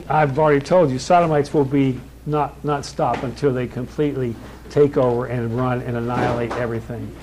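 ordinary talk

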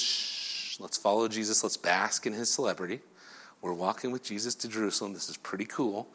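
soft, low golf-gallery murmur; hushed crowd. Acted. Crowd following Jesus as if at golf, hushed. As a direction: start with a shush and keep it down. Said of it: "they have to be quiet".

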